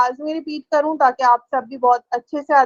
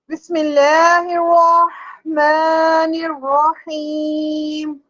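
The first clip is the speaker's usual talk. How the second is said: very slow, melodic chanted recitation; Quran recitation; much slower than usual. Acted. Teacher begins Surah al-Fatiha for her students, chanted slowly. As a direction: draw every syllable out in a sung tilawat.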